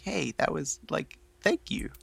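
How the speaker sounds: soft, shy little thanks; meek mumble. Retold or imitated. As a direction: mumble it bashfully. Imitates Alabaster's meek thanks.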